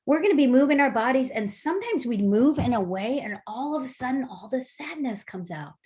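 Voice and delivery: usual speaking voice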